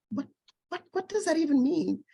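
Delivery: mock exasperated question, pitched high; a newcomer baffled by a Toronto place name, exasperated; frustrated. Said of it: "I would even get frustrated".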